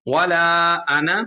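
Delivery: measured recitation with held long vowels; Quran recitation. Shown as a demonstration. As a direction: recite the verse slowly and carefully. Recites a Quran verse as example.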